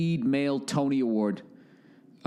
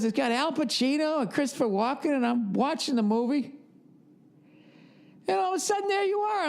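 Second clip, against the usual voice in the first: much higher, put-on voice of an excited old dad; dad impression; 13 semitones higher than usual. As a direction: play your father telling the story on the phone. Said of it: "He goes".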